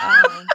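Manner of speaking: very high, loud burst of laughing; acted laughter. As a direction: re-enact a big shrieking laugh. Acts out how they laughed.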